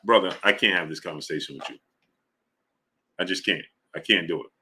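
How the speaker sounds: low, soft and defeated; worn out by the argument; resigned. Real, not acted. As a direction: trail off, exhausted. Gives up on argument.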